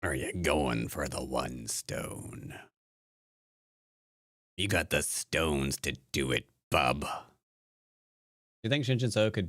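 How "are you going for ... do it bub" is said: 1 low mock taunt.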